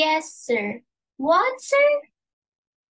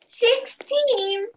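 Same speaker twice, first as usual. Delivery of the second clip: aghast; 10 semitones higher than usual. Acted. Maid aghast at number.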